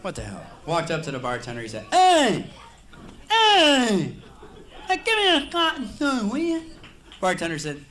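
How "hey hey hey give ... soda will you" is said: high, loud impeded speech; speech impediment voice. Retold or imitated. Customer with a speech impediment calls the bartender loudly. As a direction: shout it high with the lisp. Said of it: "Guy had a little speech impediment".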